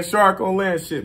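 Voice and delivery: ordinary talk, nothing marked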